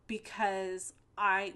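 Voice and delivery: normal talking voice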